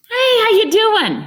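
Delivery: startling, high, loud hello; sudden loud greeting. Shown as a demonstration. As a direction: burst in from the side. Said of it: "if I come from the side and say".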